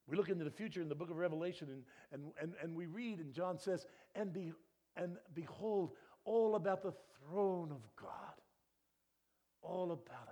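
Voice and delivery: loud, high, fast fervent preaching; preacher building to a climax; preaching at full force. Acted. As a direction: lift the room with it.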